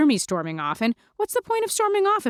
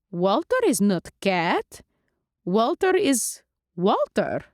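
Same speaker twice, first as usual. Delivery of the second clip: put-on vampire Lulu, accented; slower than usual. Lulu asks Mandy why she skipped dinner, accented and puzzled. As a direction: ask like a doting old-world vampire.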